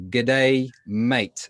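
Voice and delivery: broad Australian "G'day, mate", the day squeezed into one quick "g'day". Shown as a demonstration. Teacher modelling a broad Australian "G'day, mate" for the class. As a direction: model a friendly Australian greeting. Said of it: "we are all going to practice our Australian accents".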